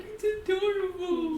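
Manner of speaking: high, stretched-out gushing; high-pitched cooing. Acted. Girl showing off her tiny whip, high slow cooing. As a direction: coo over it like a tiny pet.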